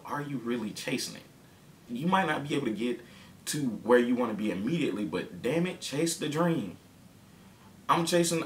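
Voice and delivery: high, rousing sermon voice; preaching. Acted. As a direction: preach it to the listeners like a pulpit. Preaching about chasing dreams.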